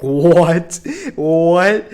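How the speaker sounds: loud stunned disbelief; loud shocked double what. Retold or imitated. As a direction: blurt it out, floored by the news. Hears friends got suspended.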